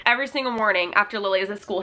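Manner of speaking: usual speaking voice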